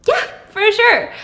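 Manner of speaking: awkward agreement. Retold or imitated.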